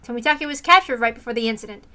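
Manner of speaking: ordinary delivery, nothing marked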